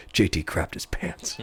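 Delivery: low, hushed stern dad. Retold or imitated. Brother retelling dad's grave whisper, stern and hushed. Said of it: "so stern in the eyes".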